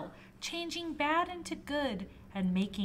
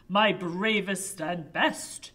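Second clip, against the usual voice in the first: commanding king voice; 2 semitones lower, 6 dB louder than usual. Acted.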